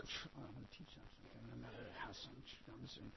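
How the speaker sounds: muttered fast under the breath; mumbling under breath. Pretend. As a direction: grumble it so they can't hear. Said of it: "mumbling under your breath".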